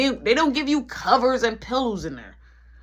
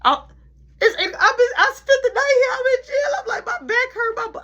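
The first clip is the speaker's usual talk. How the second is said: loud, high-pitched mock wailing; crying; 7 dB louder than usual. Calling grandpapa from jail, hammed-up high crying. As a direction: imitate sobbing pleas, pitched way up. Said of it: "I'm crying".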